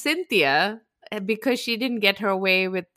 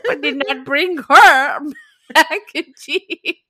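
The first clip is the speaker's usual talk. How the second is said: slow, louder, laughing through the words; 3 semitones higher, 6 dB louder, slower than usual. Real, not acted. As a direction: giggle through the end of the story. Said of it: "laughter through words".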